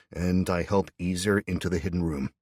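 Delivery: plain reading voice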